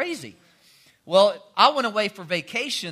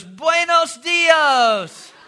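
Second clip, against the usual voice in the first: put-on high, loud, very slow Mexican-accented Buenos dias; Spanish greeting; 10 semitones higher, 8 dB louder, much slower than usual. Jesus greeting the two friends in a hammed-up Spanish accent. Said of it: "sees them, and goes".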